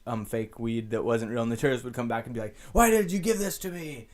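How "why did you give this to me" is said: high-pitched imitation of an upset customer; mock complaining tourist. Imitated tourist complaining about fake weed.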